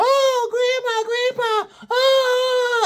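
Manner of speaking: put-on very high shrieking child voice; high wailing kid squeal; screaming kid. Kids screaming for grandparents.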